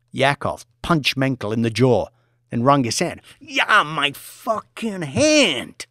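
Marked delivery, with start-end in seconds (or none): 3.4-5.8 pained groan. Acted. Pain after punching.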